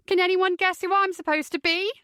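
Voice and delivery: high, loud shriek of a question; high-pitched party shriek. A narrator's reading. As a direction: shriek it out, drunk and gleeful. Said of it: "she shrieks".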